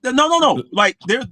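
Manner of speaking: high, loud no after no; shouting him down with a string of no's; loud protest. Real, not acted. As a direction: cut him off hard and loud. Cutting off the other speaker.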